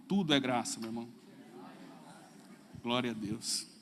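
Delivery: mock biblical prophet. Shown as a demonstration. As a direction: intone it like a bearded prophet. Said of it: "Agora faz com voz de profeta".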